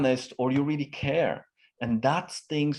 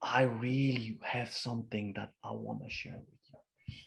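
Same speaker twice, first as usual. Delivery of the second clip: softer, hushed confiding voice; secretive tone; 8 dB quieter than usual. Shown as a demonstration. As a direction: lean in and share it like a secret. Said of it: "this tone of".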